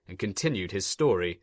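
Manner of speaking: ordinary delivery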